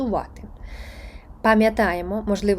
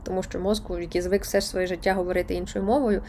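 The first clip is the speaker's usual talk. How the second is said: usual speaking voice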